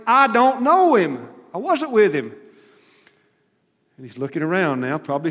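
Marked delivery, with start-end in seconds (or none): 0.1-2.3 heated denial. Acted. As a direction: deny it hard, every word hammered.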